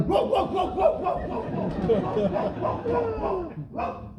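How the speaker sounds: rapid volley of high barks; mock dog barking. Imitating a dog at passing trains, frantic barking. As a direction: bark on and on, fading out. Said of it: "You know those dogs".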